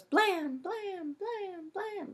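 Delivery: echoing blam. A narrator's reading. Narrator's gunshot echoes through the skull, ringing and fading. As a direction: let each blam ring away like an echo. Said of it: "like an echo through my skull".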